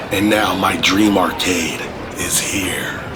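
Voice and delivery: slow breathy reveal whisper. Acted. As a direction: hush it like a secret reveal. Reveal of dream arcade.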